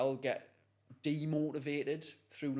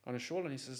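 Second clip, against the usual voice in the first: ordinary delivery, nothing marked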